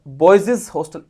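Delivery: put-on बॉयज said as "boy-ziz"; doubled s. Shown as a demonstration. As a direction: add an extra "iz" to बॉयज. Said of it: "इसकी pronunciation गलत है".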